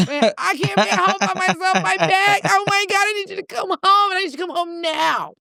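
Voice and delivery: high-pitched panicked wail, reenacted; imitated hollering. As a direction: holler frantically for help, hammed up. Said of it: "the voice note was me hollering".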